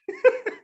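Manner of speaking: a squeal of real laughter; sudden high laugh; high burst of laughter. Real, not acted. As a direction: crack up at your own punchline. Laughs at punchline of story.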